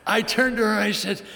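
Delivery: high, loud mock-patriotic tirade; a man lecturing his wife about fighting for principle, hammed-up and loud; mock heated rant. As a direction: ham up a fired-up speech about principle. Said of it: "speaker's own rant retold".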